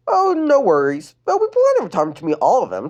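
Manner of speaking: put-on high sweet girl voice; bubbly girl, high and eager; high cheerful teen girl; Lenny voice.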